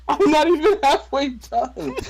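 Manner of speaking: squealing he's not even halfway done; high exasperated whine. Real, not acted. Exasperated aside.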